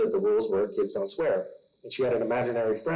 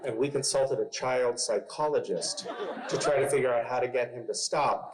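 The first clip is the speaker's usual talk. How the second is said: usual speaking voice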